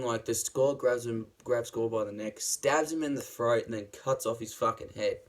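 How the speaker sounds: usual speaking voice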